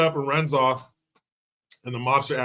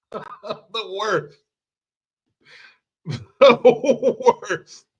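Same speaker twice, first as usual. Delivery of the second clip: high, helpless laughing speech; 7 semitones higher, 4 dB louder, much slower than usual. Real, not acted. Comic cracking up at his own bit, laughing. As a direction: talk through real laughter.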